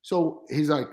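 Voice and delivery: ordinary delivery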